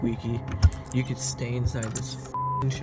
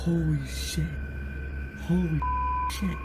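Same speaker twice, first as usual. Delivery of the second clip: shaken, startled and breathless; real fright, words tumbling out; adrenaline shakes; 4 semitones higher than usual. Real, not acted. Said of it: "Fucking hands are shaking".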